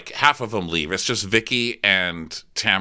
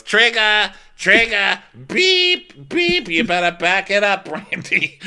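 much higher and louder, like a backing-up truck; mock warning beeps; 13 semitones higher, 4 dB louder, slower than usual. Joe warning Brandy she's triggering Dorinda, high mock beeping. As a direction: sound the alarm, teasing. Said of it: "Joe goes".

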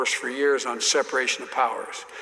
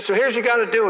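higher, louder mock Southern senator; folksy senator explaining; 8 semitones higher, 4 dB louder, much faster than usual. Senator lecturing the young colleague on oil wells, hammed-up. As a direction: patiently explain like an old senator. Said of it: "He said".

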